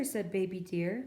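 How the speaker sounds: usual speaking voice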